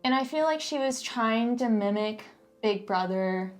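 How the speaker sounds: ordinary delivery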